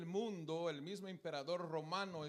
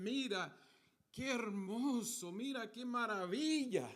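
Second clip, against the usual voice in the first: disciples' awed exclamation, rising higher; acted admiration; 5 semitones higher than usual. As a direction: marvel aloud, imitating amazed disciples. Disciples admiring the temple to Jesus. (admiración exclamada, imitando a los discípulos)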